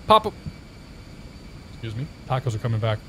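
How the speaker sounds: normal talking voice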